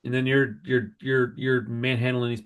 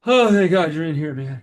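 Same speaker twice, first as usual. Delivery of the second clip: louder, higher burst of relief; relieved; 4 semitones higher, 6 dB louder than usual. Retold or imitated. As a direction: let the relief rush out on a big sigh. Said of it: "I was probably like".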